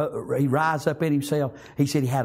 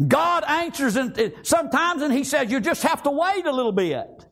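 raised, ringing pitch on scripture; impassioned preaching; 10 semitones higher than usual. Real, not acted. Preacher quoting Jesus' promise to the congregation, impassioned. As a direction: preach the verse out high.

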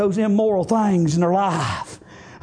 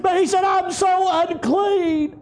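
sudden high, shouted preaching; full-force preaching; 14 semitones higher than usual. Acted. Preacher retelling the leper's confession, full-force preaching. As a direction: cry it out with revival force.

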